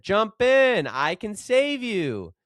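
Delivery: high, loud call, slowing on save you; shouted offer. Acted. Motorboat rescuer calls up to the roof, shouting. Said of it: "The fellow in the motorboat shouted".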